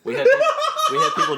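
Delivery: laughing through the words; giggling while speaking. Real, not acted.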